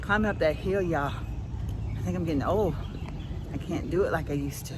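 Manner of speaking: out of breath. Real, not acted. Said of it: "I'm completely out of breath".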